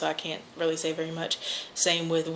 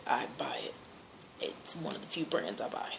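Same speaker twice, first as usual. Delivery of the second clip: whispered, under the breath; whispered aside; 4 semitones lower, 12 dB quieter than usual. Real, not acted. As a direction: whisper it like a confession. Aside about buying Dawn.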